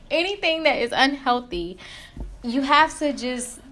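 high, giggly restart; laughing it off. Real, not acted. Laughs at own outburst.

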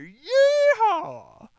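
huge high yeehaw holler; cowboy yell. Pretend. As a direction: whoop it like a rodeo cowboy. Cowboy cheer about American tobacco.

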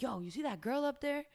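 impressed stranger. Retold or imitated. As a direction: perk up as if spotting someone impressive. Dad's friend admiring at event.

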